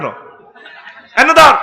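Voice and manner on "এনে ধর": loud laughing call to the crowd. Real, not acted. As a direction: shout it out with a laugh. Preacher calling out to crowd.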